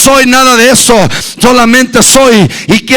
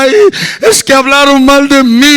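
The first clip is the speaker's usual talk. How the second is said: mock whimper. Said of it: "se agarraba llorando y decía".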